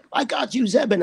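high, urgent call to a trapped castmate; retold heroic shout, pitched well up; called-out rescue line. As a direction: yank him back with a shout of reassurance.

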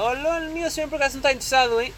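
high, fast sales patter; imitating a mall saleswoman's pitch; pushy saleswoman. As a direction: call out to passers-by like a mall seller. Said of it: "tu passas e ela".

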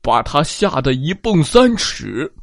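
proud lion boasting to his rivals; boastful lion. Acted. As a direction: show off your roar. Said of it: "狮子自夸道".

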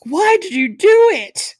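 loud, high, drawn-out hammed-up wail; over-the-top exasperated cry, played for laughs; exaggerated groan. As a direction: ham up the groan, slow and loud. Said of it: "they groaned".

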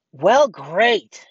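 loud, high mock-sarcastic "great". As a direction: exaggerate the customer's sarcasm. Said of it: "being sarcastic".

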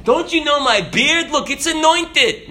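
mock Jesus showing off his beard to the disciples. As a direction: lampoon a vain Jesus, tongue in cheek. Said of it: "No, he didn't say that".

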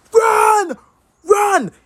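high, excited yell of run; frantic fan shouting at the TV. Retold or imitated. Retelling his shout at the play.

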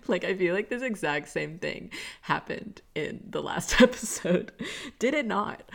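laughing through the words, real amusement. Real, not acted. To listeners about the dog's crunching, laughing. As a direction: giggle while asking, amused.

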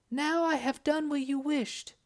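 high, eager witch voice. Acted. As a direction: report eagerly as the enchantress. Enchantress reports to Sultan.

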